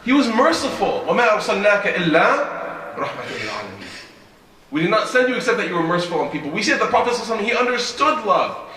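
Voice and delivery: high, loud, fervent sermon delivery; impassioned preaching. Real, not acted. Preaches with raised voice.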